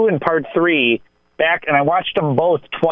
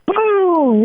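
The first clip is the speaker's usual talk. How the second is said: high, punchy boom; mock boom. Telling of the scary scene, high playful boom. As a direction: pop the word like a jump scare. Babysitter grabs him, retold.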